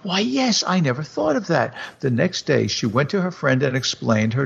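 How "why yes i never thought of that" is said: higher, delighted realization; light it up with sudden joy; mildly excited wife; cry of joy. Acted. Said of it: "a little cry of joy".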